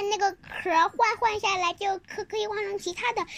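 ordinary delivery

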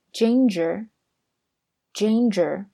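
slow, careful ginger, ginger with every sound drawn out; teacher's model word. Shown as a demonstration. Teacher models ginger for students, slow and clear. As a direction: say ginger slowly for the class to copy. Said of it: "那姜呢，叫做".

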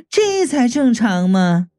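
slow, drawn-out coquettish remark; amused, coy woman. A narrator's reading. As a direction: stretch it out with teasing amusement. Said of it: "三娘笑道". (拖长的娇媚女声)

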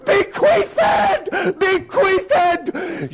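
enraged headmaster screaming at a pupil. Retold or imitated. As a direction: scream the word at the boy like a mad dog. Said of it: "he began to scream".

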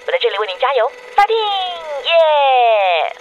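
bright, bouncy cheer with a loud yay at the end; excited cheer. Acted. Girlfriend cheering her boyfriend through an answering machine, excited cheer. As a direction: cheer him on like a fan.

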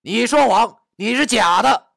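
loud, high accusation; high-pitched, full-voiced berating; furious scolding. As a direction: yell it as an angry accusation. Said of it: "对他大骂道".

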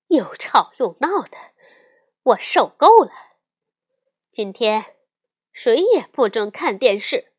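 loud, fed-up mother's outburst; exploding with anger. A narrator's reading. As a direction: erupt like mama bear laying down the law. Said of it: "熊妈妈终于火山爆发了". (火山爆发般大声怒斥)

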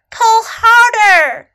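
high, loud, drawn-out shout. A narrator's reading. Snake urging little elephant to pull, shouting. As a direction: stretch the command into a rallying shout. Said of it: "shouted Snake".

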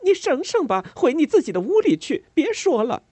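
high, loud rebuke; sharp scolding shout. A narrator's reading. Maid scolding the neighbour to go home. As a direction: raise your voice and order her off. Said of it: "提高了嗓门呵斥".

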